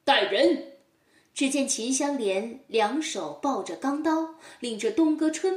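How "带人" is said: loud commanding call. Acted. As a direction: bark the order to the guards. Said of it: "随即喝道". (包公大声喝令带人)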